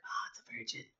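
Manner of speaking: soft, voiceless aside; whispered realisation. Acted. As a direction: whisper it as it dawns on you. Re-enacting the quiet realisation.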